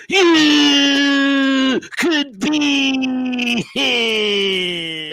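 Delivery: high, slow, stretched hype shout; drawn-out shout. Pretend. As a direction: stretch it out like a ring announcer. Builds up Jeff as lookalike.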